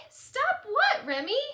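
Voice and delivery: put-on high, loud teasing kid voice; taunting child. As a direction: needle her like a smug kid. Child answering back in quarrel.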